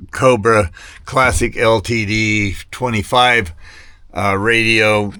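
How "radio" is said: ordinary talk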